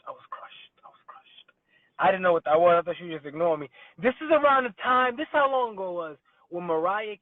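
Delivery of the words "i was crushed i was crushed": softer then whispered, crushed; mock heartbreak fading into a whisper. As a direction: let it die away to a breath.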